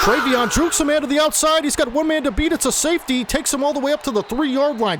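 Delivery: rising, laughing play-by-play call; excited. Real, not acted. Streamer calls his player's long run, excited. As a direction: jump up in pitch as the run breaks open.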